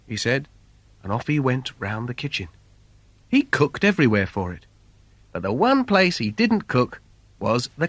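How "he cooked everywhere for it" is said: usual speaking voice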